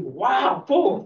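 delighted "Wow, Paul" rising on Paul; wowed reaction. Shown as a demonstration. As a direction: gush it like you're truly impressed. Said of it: "you should be saying".

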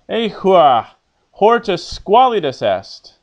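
loud, emphatic model line for the class to repeat; projected Latin model line; teacher's model reading. Shown as a demonstration. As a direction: read it out clearly as the character, with feeling. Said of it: "I'll read what the character says".

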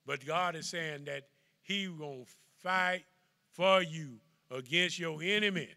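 loud preacher's drive; preaching at full force. Acted. Preacher promising the flock God stays with them, loud.

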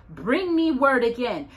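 ordinary delivery

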